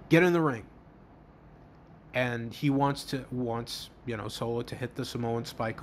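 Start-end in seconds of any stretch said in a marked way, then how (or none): 0.1-0.6 louder, higher imitated command; barking orders. Roman ordering Solo into the ring, barking. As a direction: order him in, a retold bark. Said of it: "Starts barking orders at Solo".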